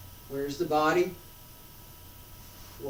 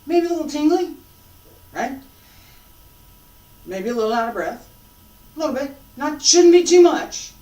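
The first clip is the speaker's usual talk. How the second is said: excited; 9 semitones higher, 13 dB louder than usual. Real, not acted. Animated teacher describing sensation.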